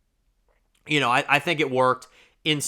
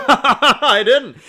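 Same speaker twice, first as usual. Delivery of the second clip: louder, higher laughing repeat; 12 semitones higher, 8 dB louder than usual. Real, not acted. Reviewer laughing at his own joke about the song, loud. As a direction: laugh the words out loud and high.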